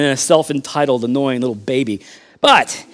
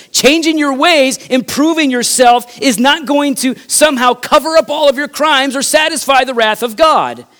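rising preacher, louder toward going to hell; building sermon; 7 semitones higher, 4 dB louder than usual. Acted. Pastor warning the congregation they must change their ways, building. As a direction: press the warning harder each time.

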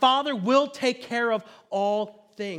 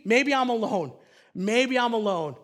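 ordinary delivery

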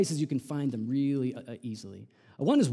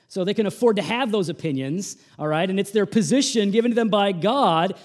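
louder, driving sermon delivery; forceful preaching; 5 semitones higher, 9 dB louder than usual. Acted. Preacher telling the congregation parents can be hard, forceful preaching. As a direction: hammer the point home from the pulpit. Preacher pressing his point.

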